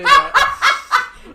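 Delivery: slow, laughing aside. Real, not acted. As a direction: say it through laughter.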